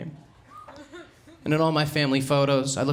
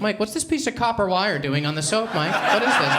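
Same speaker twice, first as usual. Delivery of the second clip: high, louder mock-disgusted roommate; 8 semitones higher, 4 dB louder than usual. Roommates confronting Mike about the soap, in a high mock-disgusted voice. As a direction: hold it up and demand an answer.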